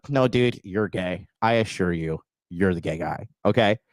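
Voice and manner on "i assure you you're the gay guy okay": normal talking voice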